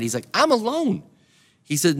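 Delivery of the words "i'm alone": high-pitched lament, not shouted; acted plea, pitched well up; lonely cry. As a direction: cry it out as one left all alone. Voices David's lonely cry in cave.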